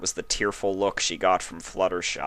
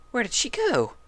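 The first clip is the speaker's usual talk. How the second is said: lightly voiced puzzled mare. A narrator's reading.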